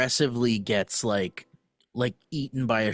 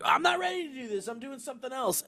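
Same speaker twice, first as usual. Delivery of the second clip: high, quick protesting host; flustered host; 11 semitones higher, 6 dB quieter, faster than usual. Retold or imitated. Host objecting to the sound guy's cue, high and quick. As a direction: protest as the rushed emcee. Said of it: "the host is like".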